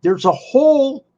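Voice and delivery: high, loud retold outcry; mock indignation. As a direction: imitate an appalled complaint, drawing out the end. Retells wrestler's reaction to photo.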